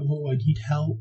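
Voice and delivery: high, pleading mock-hysterical cry for help; retold hysterical wife; hysterical plea. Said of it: "She's, like, all hysteric".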